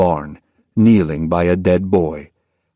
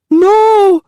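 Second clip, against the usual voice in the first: high, loud cry of horror; girl screaming as mandrake burns; anguished scream; 8 dB louder than usual. Acted. Girl sees root thrown in fire.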